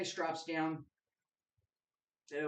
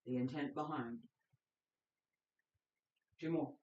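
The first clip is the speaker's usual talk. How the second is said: steady instructor cues, exhale words pushed out; guided qigong cues; 3 semitones lower than usual. Acted. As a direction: lead the class through breath and movement. Leads qigong breathing practice.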